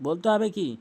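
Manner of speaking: ordinary talk, nothing marked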